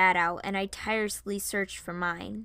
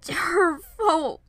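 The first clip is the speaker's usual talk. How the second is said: loud, high, broken by a pause; angry blame; 10 semitones higher, 8 dB louder, much slower than usual. A narrator's reading. Varian blames the princess for his dad, angry. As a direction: spit out the blame in two bursts. He yelled, then hugged himself.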